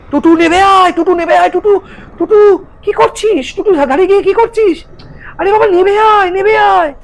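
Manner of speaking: very high, loud desperate calling; shouting. Acted. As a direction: scream for him to come down. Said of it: "সবাই মিলে চিৎকার করে ডাকছে".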